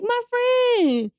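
high-pitched excited reply; giddy. Retold or imitated. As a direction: answer in a giddy squeal. Giddy answer to boyfriend, retold.

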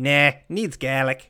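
slow, light imitation of an Australian nah; a hint of the Australian character's accent, unhurried; loosely mimicked Australian drawl. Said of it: "his specific inflection of how he is talking".

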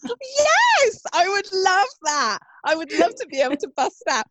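high, drawn-out gush of delight; excited delight. Real, not acted. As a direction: leap up high and stretch out the eagerness. Delighted at an offer of help.

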